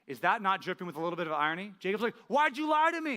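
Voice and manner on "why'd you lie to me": much higher, louder hurt protest; indignant outcry. Retold or imitated. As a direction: cry out at the betrayal. Jacob outraged at Laban's trick.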